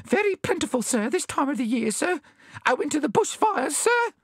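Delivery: high, squeaky nervous babble; trembling little bandicoot voice; gulped in fear. A narrator's reading. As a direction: explain meekly in a frightened squeak. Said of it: "gulped the bandicoot".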